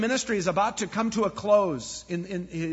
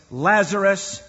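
loud call; 7 dB louder, slower than usual. A narrator's reading. Jesus calling Lazarus out of the tomb, loud call. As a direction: call the name out loud, ringing. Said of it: "Jesus called in a loud voice".

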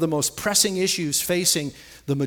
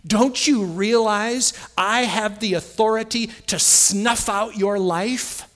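raised, forceful threat, slowing at the end; mock scream; 5 semitones higher, 4 dB louder, slower than usual. Trouble threatening the believer in our ear, loud menace. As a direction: bear down loudly, drawing out the last words. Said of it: "That's what it screams in our ear".

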